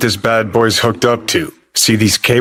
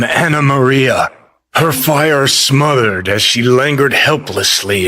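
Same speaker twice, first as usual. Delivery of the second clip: higher, theatrical melodrama narration; mock soap-opera announcer; 5 semitones higher than usual. Recapping Antonio and Anna Maria's soap saga, mock melodramatic. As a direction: ham up the cliffhanger recap with mock suspense.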